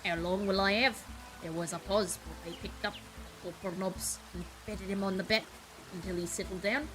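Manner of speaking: put-on improvised British accent, Cockney gone posh; Cockney trying posh. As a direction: lean into a would-be-posh Cockney read. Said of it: "British Cockney trying to sound posh".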